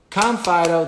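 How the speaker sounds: high, loud recall command; calling the dog. Shown as a demonstration. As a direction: bring the dog back with a sharp high call. Recalling the dog that ran past.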